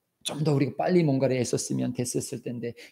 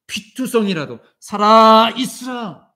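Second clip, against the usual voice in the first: loud, slow, emphatic declaration; proclamation; 6 semitones higher, 11 dB louder, slower than usual. Acted. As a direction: proclaim life over the despairing. God proclaims to listener. (크고 느리게 선포하듯)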